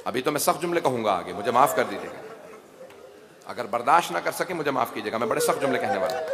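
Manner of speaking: quick, soft apology to the audience; soft rapid aside before harsh lines; hushed aside. Real, not acted. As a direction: drop the voice and hurry through.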